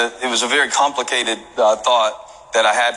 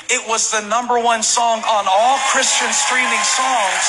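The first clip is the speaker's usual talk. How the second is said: full-force preaching; 14 semitones higher than usual. Acted. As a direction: preach it out with rising fire. Preaching climax to congregation.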